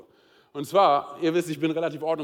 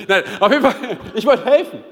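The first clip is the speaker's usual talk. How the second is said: laughing insistence; 6 semitones higher, 9 dB louder than usual. Real, not acted.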